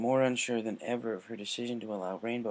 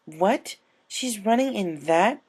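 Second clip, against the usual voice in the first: higher, slower, disbelieving question; surprised; 10 semitones higher, 5 dB louder, slower than usual. Acted. Twilight hearing Rainbow will race, surprised. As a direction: lean on running in disbelief. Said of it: "Twilight was surprised".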